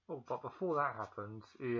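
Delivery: usual speaking voice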